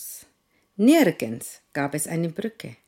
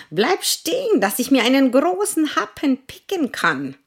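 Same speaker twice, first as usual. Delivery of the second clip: hohe, laute Hahnenstimme; Hahn; 9 semitones higher, 8 dB louder than usual. Acted. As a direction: gackernd fragen. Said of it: "fragte der Gockel".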